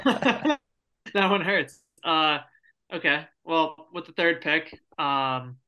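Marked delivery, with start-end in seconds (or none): 0.0-1.8 repeats it with a laugh; laughing at the same words; laughing through it. Real, not acted. Repeats the line while laughing.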